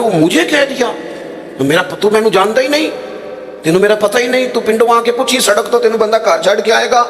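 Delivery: high, worked-up Punjabi tirade; picking a quarrel. Retold or imitated. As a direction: rant like a touchy villager spoiling for a fight. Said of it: "اگر آپ نے الجھاؤ پیدا کرنا ہے".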